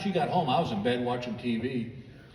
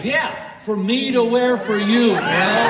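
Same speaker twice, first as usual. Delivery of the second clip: higher, louder, slow, laughing put-on wife voice; exasperated wife; 7 semitones higher, 7 dB louder, slower than usual. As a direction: spell it out to your clueless husband. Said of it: "She goes".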